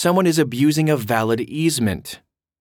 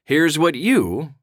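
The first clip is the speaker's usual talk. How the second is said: ordinary talk, nothing marked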